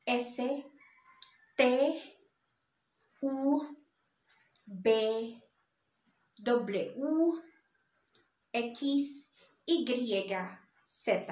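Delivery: each letter named in Spanish, N as "ene", separated by pauses; Spanish letter names. Shown as a demonstration. Teacher going through the ABCs for learners, Spanish letter names. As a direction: recite M to Z with their Spanish names. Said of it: "teach you how to pronounce".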